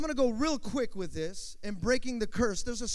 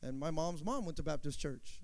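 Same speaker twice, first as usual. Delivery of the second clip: lower, softer, a lightly voiced shrugging churchgoer; 7 semitones lower, 9 dB quieter than usual. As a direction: mumble it like a habit you never questioned. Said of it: "Why do you go to a Baptist church?".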